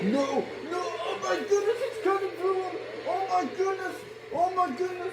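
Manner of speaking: very high, loud horrified screams; hysterical shrieking. Acted. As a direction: shriek as it cuts through him. Watches Patrick being sawn.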